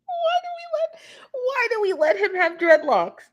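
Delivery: high, squealed disbelief through laughter; laughing disbelief. Real, not acted. Fan aghast at a boy band's dreadlocks, laughing incredulously. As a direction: crack up while asking, pitch way up.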